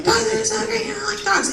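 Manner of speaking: high, chirpy voice gushing over dogs; mock high lady voice. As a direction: squeak it up like a dog-loving coworker. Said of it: "I'm like".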